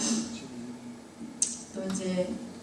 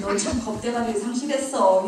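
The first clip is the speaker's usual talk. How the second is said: ordinary talk, nothing marked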